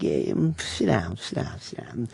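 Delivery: low, fading mock Pesci, trailing to a whisper; lazy tough-guy wave to sit; nervous comedian imitating a gangster actor telling him to sit; Pesci impression. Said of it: "He's like".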